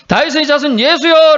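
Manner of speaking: high, urgent calling out; shouting. Acted. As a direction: cry out so he stops. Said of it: "소리치면서". (높은 소리로 크게 외치며)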